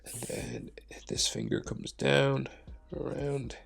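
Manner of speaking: whispered self-talk while drawing; muttering to myself. Real, not acted. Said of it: "Talking to myself".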